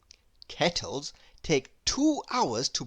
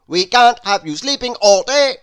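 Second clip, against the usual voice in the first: loud, high and bossy; Mister Busy hurrying Lazy up, loud and bossy; brisk busy man; 4 semitones higher, 13 dB louder than usual. A narrator's reading. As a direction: chivvy him out of bed. Busy hauls Lazy to his feet.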